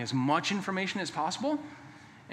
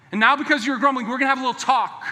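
louder, higher stern parent warning; the dad calling the kids in for a talk; 6 semitones higher, 7 dB louder, faster than usual. Acted. Father warning his sons they're in trouble, loud and stern. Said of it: "You ever use that language with your kids?".

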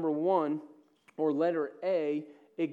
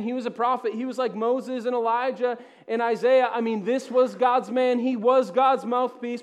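louder, higher, driving sermon voice; full-force preaching; 6 semitones higher, 8 dB louder than usual. Acted. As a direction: preach it to the whole room with fire.

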